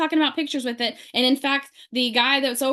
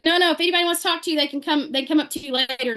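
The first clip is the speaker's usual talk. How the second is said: fast brush-off; 3 semitones higher, faster than usual. Retold or imitated.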